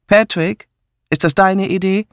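sharp questioning. A narrator's reading. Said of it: "fragte sie scharf". (scharf nachgefragt)

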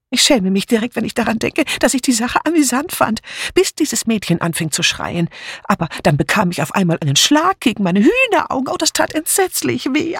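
high, fast, dithering spinster voice; flustered Miss Murgatroyd. A narrator's reading. Miss Murgatroyd fumbling her alibi for the inspector, flustered.

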